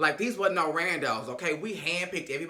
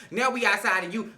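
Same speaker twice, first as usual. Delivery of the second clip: ordinary delivery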